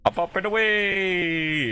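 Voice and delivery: high, slow, loud superhero cheer; excited cheer. Pretend. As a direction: belt it out like taking off. Rising in elevator, cheering.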